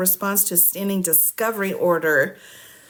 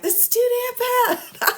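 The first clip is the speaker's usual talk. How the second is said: much higher, squeaky child imitation; little kid voice. Mother quoting her little child at home, squeaky. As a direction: mimic a small child saying it. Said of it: "a little voice in my house".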